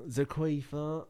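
put-on slow, drawn-out accented Zaquifa; Latin American accent. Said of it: "I lose the accent".

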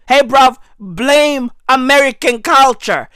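normal talking voice

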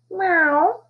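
high playful meow; a cat teasing with a meow; a lightly voiced amused cat; laughing meow. A narrator's reading. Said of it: "the cat laughed".

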